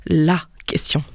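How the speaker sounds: whispered, ominous; the dreaded question, whispered; dramatic whisper. Acted. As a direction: hush it like a secret. Dramatic build-up to taboo question.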